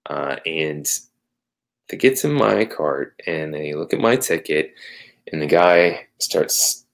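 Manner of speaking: usual speaking voice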